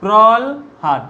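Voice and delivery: প্রলহাদ stretched very slowly as prol-had; teacher slowly spells out প্রলহাদ as prolhad; slow pronunciation demo. Shown as a demonstration. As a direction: draw out প্রলহাদ syllable by syllable, l before h. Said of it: "কি উচ্চারণ হচ্ছে দেখো?".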